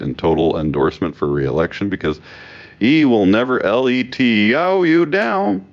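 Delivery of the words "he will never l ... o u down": higher and slow, letters spelled out; all-caps slogan. Retold or imitated. Commentator quoting the repeated endorsement promise, slow all-caps emphasis. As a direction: draw out the capitalized promise in a raised voice. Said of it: "The capitalization is the only thing working harder".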